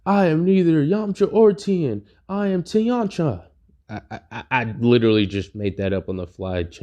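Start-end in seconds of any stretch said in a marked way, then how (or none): 0.1-3.5 higher, proud announcing voice; lightly voiced fused hero, pitched up; fused warrior. Fused fighter announces himself.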